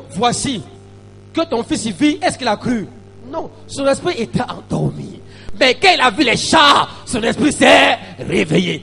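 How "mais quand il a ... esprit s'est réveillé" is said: loud, emphatic preacher's outburst; climactic preaching. Real, not acted. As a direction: shout the turning point to the congregation. Preaches the climax at full force.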